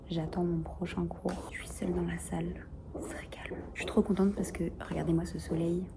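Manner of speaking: hushed classroom whisper. Real, not acted. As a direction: keep it down, alone in class. Alone in a quiet classroom.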